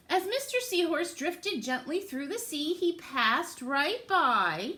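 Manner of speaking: lively, sing-song storybook reading; picture-book read-aloud voice; reading to children. Acted. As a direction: read it to little ones with big rises.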